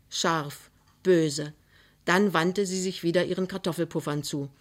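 plain reading voice

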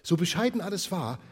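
ordinary delivery, nothing marked

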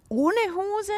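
usual speaking voice

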